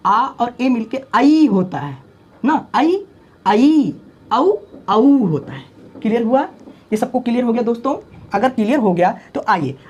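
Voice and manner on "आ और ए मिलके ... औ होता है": आई and औ said slowly and long; drawn-out vowels. Shown as a demonstration. Teacher demonstrating diphthongs slowly. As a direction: stretch each diphthong slowly.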